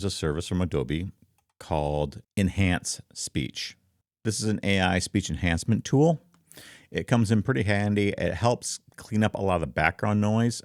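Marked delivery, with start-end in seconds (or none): none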